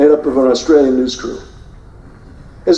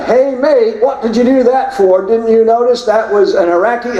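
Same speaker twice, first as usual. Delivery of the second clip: higher-pitched imitation of an Australian newsman calling out; mock Australian shout; 7 semitones higher than usual. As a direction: call out "Hey, mate" like an Aussie reporter. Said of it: "the Reuters news crew shouts out".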